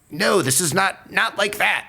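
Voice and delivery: slow, high-pitched exasperated protest; frustrated. Retold or imitated. Captors correcting a baffling prisoner, frustrated. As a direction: imitate an interrogator at wit's end, drawn out. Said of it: "they were getting so frustrated".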